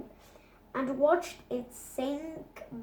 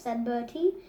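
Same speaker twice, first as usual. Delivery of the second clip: ordinary delivery, nothing marked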